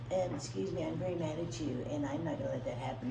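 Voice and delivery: mock meek and soft, very politely angry; polite quiet voice. A Connecticut man telling someone off, soft and meek. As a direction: say it softly like a timid polite guy. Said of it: "they say".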